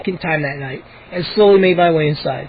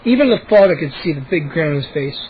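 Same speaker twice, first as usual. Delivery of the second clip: usual speaking voice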